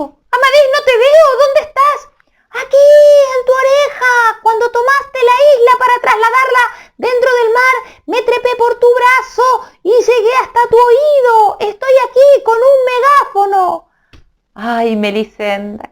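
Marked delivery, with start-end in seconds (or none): 0.0-2.0 high calling voice. Acted. Melisenda searches for him. 2.5-13.9 very high, small little-man voice; tiny voice. Acted. Tiny Amadís comforting crying Melisenda from her ear: very high squeak.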